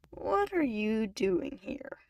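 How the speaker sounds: slow, drawn-out question; slowly. A narrator's reading. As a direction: stretch every word as if still registering her. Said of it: "he asked slowly".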